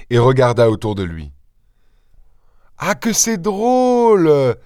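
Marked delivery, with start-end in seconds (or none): 2.8-4.6 high, long drawn-out amusement; amused exclamation. A narrator's reading. Stranger struck by his discovery, amused. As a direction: stretch it out high, greatly tickled. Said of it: "en partant d'un éclat de rire".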